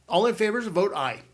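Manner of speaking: rally them to vote aye; calling a vote. A narrator's reading.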